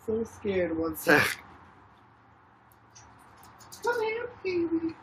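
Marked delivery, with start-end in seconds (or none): 3.8-4.9 pet cooing. Real, not acted. Owner coaxing her hurt dog over, soft high cooing. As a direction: coo gently to a pet.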